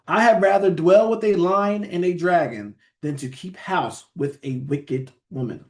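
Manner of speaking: solemn verse read, slowing at the end; scripture reading. Acted. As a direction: read the proverb out to the congregation. Reads scripture verse aloud.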